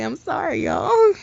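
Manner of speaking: high, still laughing; sorry squeezed out through laughter; giggling high apology. Real, not acted. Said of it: "I'm sorry to laugh".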